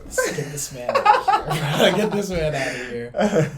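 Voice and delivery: loud, high teasing through laughter; laughing. Real, not acted. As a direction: crack up while mock-ejecting a friend. Laughingly teases co-host about camera.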